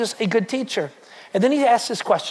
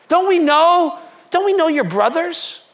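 higher, louder scoffing "don't we know"; mocking townsfolk; 7 semitones higher, 4 dB louder than usual. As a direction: sneer it like a doubting neighbor. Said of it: "They, they say".

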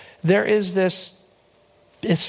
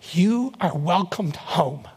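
"You" held long, each word spaced; slow, weighty welcome; much slower than usual. Retold or imitated. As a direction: deliver it slowly, giving each word room. Mother's words retold, first take.

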